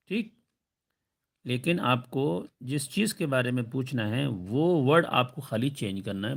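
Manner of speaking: ordinary talk, nothing marked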